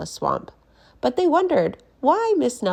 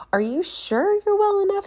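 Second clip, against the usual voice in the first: very high, fussy principal voice; 4 dB louder than usual. Acted. Mister Blandsworth worries aloud, very high and fussy. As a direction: fret over the teacher's health. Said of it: "said Mister Blandsworth".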